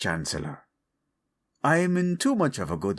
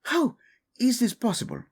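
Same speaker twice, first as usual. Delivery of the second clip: rising higher and higher in mock dismay; exasperated disbelief; 10 semitones higher, 3 dB louder, faster than usual. King to his chancellor, incredulous and exasperated. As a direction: act stunned at the pile of paperwork, pitch climbing.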